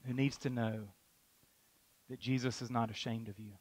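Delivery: almost whispered, intimate and soft; hushed intimate. Real, not acted. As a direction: lean in and say it softly. Repeated line, much softer.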